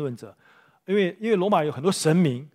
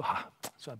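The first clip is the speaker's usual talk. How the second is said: sigh-like aside; 3 semitones lower, 12 dB quieter than usual. Retold or imitated. Romans relenting in retold story.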